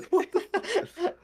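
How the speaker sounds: squeaky high startled cutoff; mock startled squeal. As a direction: yelp it out high and slow. Acting out cave surprise.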